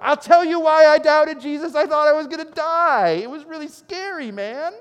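high, loud, pleading protest; exasperated Peter. Acted. Peter answering Jesus after the storm, high and loud protest. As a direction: blurt it out, still shaken.

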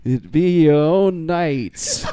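slow, loud knightly lament through laughter; mock archaic knight. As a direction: lament grandly like a betrayed knight. Mock archaic fantasy player lines.

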